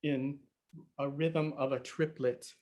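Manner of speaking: ordinary talk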